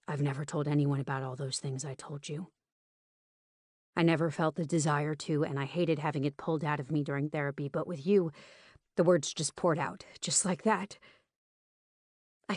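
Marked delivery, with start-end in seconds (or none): 0.1-11.0 lightly voiced man confiding, subdued; a hint of the male lead's lower voice; lightly voiced Rowan. Man confides in his date, lightly voiced and subdued.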